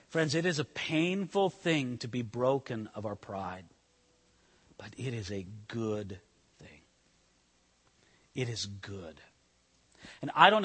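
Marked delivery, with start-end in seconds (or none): 0.1-9.2 soft, lower, gentle assurance; tender. Real, not acted. Preacher comforting the congregation, soft and tender.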